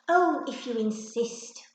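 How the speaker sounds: slow, loud, put-upon sigh of a yes; reluctant agreement. A narrator's reading. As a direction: give in grudgingly. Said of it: "agreed reluctantly".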